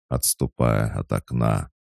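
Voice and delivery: ordinary delivery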